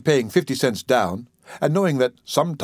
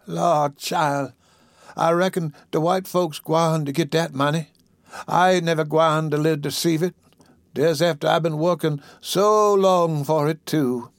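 put-on higher, plaintive old woman's voice; weak invalid; 4 semitones higher than usual. Sick woman doubting she'll see her pension, plaintive. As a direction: lament as an ailing woman, drawing out the end.

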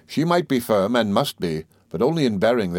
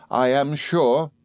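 slow, higher, mildly tearful; a touch of tears in a drawn-out protest; tearful mother; 6 semitones higher, much slower than usual. A narrator's reading. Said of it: "and with many tears".

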